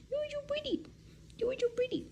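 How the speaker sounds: high, soft cooing at the dog; squeaky doting pet voice. Real, not acted. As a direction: coo sweetly to a pet.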